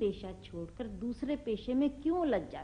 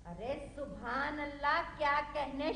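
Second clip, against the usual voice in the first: higher-pitched sarcastic praise; mocking echo; 4 semitones higher than usual. Mother mimicking father's praise of their son, sarcastically. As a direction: parrot the admiration, rising in pitch with scorn.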